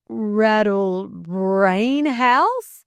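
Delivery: loud, very drawn-out indignant question, rising on HOUSE; bridling. A narrator's reading. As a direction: repeat it affronted, stretching every word. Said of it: "bridled Delcote".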